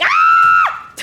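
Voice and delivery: very high loud shriek; terrified scream. Real, not acted. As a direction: scream in fright. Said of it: "I just screamed so loud".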